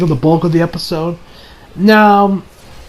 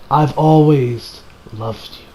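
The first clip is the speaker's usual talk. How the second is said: dying confession; 5 semitones lower, slower than usual. Acted. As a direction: confess it gently with a last breath.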